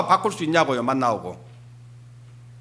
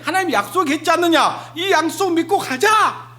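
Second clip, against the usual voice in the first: higher, eager urging voice; rally the crowd to keep faith; urging fellow travelers; 9 semitones higher than usual. Acted. Israelite encouraging the people, raised eager voice.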